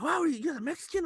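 high, astonished "Wow"; mock astonished driver; amazed driver. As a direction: imitate a driver's shock. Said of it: "he was like".

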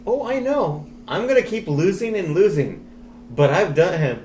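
high, lightly voiced cocky hero; high mocking voice. Acting out Doctor Strange.

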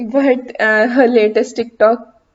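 usual speaking voice

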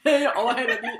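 big, loud mock cyclist's yell; shouted warning. Cyclist announcing a pass to startled riders, loud shout. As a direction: belt out the overtaking call. Repeats the shouted overtaking call.

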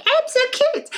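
very high, tiny squeaky voice; cutesy cat. Acted. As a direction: squeal it like a pet showing off. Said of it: "being like".

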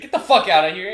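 high, loud mock instructor yell; angry dismissal. Drill instructor sending away a stammering recruit, mock angry yell. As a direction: chase the recruit off with a shout.

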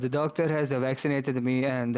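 a light, mock Russian accent. Friend calls out the Russian accent, light mock Russian accent. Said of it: "that is Russian accent".

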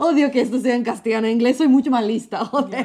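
loud laughing self-mocking outburst. Real, not acted. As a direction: laugh through the words, embarrassed.

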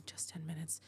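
soft unvoiced whisper; whispering to herself in the bathroom, hushed. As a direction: whisper it to calm yourself. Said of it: "she told herself".

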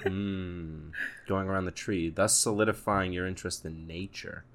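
soft, solemn analyst interpreting; deadpan mock therapist analysis. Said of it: "That was my impression of a therapist".

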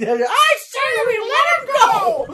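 very high, slow falsetto; shark lady voice. Retold or imitated. Host repeating his favorite shark line, slow high falsetto. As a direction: drag it out in a shrill squeak. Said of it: "That's one of my favorite things".

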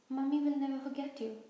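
lightly hammed-up sob; acted sobbing. A narrator's reading. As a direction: wail it like a weepy parent. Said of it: "Hagrid sobbed".

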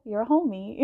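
ordinary talk, nothing marked

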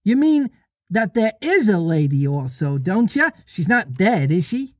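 put-on high little-boy voice; childish questioning lilt; first twin questioning. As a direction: pitch it up, a child seeking reassurance.

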